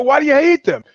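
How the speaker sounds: incredulous question. Retold or imitated. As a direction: ask it like you can't believe it. Retold own question, incredulous.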